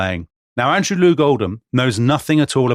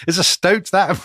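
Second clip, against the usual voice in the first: laughing; 8 semitones higher than usual. Real, not acted. Host laughing at stoat quote.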